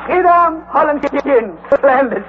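delighted. Acted. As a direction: perk up at the sight of gin. Uncle delighted by gin.

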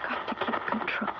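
hushed, unvoiced self-talk; barely holding on, under your breath; desperate whisper. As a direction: whisper to steady yourself. Wife alone, struggling to keep control.

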